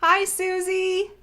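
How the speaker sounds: ordinary delivery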